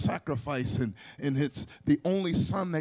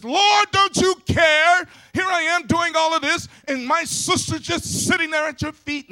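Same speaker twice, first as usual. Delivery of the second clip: angry complaint; 12 semitones higher, 11 dB louder than usual. Acted. Martha complaining to Jesus about her sister, a loud, high, angry outcry. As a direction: wail it out like a put-upon sister at the end of her rope. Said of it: "she gets angry and upset and says".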